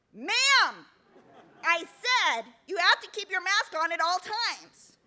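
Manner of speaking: high, slow, preachy voice; bossy scolding stranger. Retold or imitated. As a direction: insist on the rule again. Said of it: "she is shouting at me".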